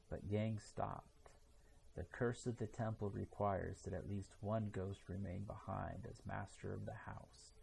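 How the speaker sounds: plain reading voice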